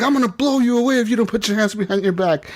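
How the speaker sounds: high, hammed-up tough cop threat; threatening cop. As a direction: bark the threat like a movie cop. Cop threatens robot with gun.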